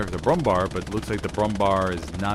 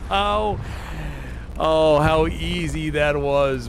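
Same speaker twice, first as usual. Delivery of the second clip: amused; 7 semitones higher, much slower than usual. Real, not acted. Marvels at easy kill.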